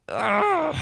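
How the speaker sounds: high whiny groan of protest; mock kid groan. Son groans at dad's request in a high whine.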